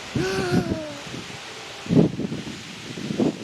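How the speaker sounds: long, audible inhale; deep breath in. Shown as a demonstration. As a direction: draw in one big, slow breath. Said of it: "take a deep breath".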